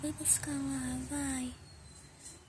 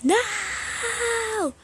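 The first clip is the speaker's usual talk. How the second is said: loud, higher pretend panic; panicked cry; 6 semitones higher, 9 dB louder than usual. Doll realizing her suitcase isn't packed before the flight, loud panic. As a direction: wail no no no in a mock fright.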